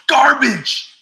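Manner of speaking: high, drawn-out holler; loud shout. Acted. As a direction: belt it out at full volume. Shouting out a viewer's comment.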